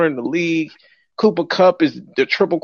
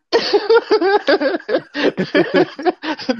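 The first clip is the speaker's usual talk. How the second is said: high burst of laughter; cracking up at the joke; big laugh; 14 semitones higher than usual. Real, not acted. Laughing at the Rams being called glamorous.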